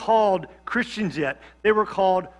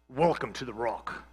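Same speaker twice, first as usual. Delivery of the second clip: put-on low, soft Scottish accent; Connery-style Scottish accent, hushed and low; Sean Connery impression; 9 semitones lower, 10 dB quieter than usual. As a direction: lightly imitate a Scottish movie star's accent. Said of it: "that was a great Scottish accent".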